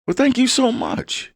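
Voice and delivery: gracious Southern thank-you; Southern accent. Retold or imitated. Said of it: "I've adopted the".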